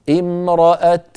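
امرأة held, ending on a voiceless bare t; stop with ishmam. Shown as a demonstration. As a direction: finish امرأة on t, no vowel sound. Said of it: "نسكن ثم نضم الشفتين". (امرأة بتاء ساكنة مع الإشمام)

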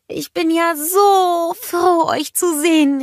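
hugging the cold ice happily; glad cry. A narrator's reading. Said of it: "rief sie".

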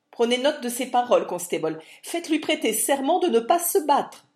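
loud, high, fast panicked shout; frantic shout. Acted. Little lawyer shouts to the constable from behind the guards, panicked. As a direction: yell orders at the constable from safety. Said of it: "s'écria le petit homme de loi".

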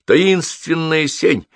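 very slow, high, drawn-out words; mysterious announcement. A narrator's reading. Мальчик зовёт девочку в лес, mysterious announcement. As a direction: stretch it out like a wondrous secret. Boy naming a mysterious place.

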